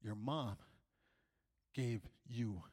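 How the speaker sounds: very low, soft, halting; grave grandma. Retold or imitated. Grandma telling the child he can't go home, hushed and ominous.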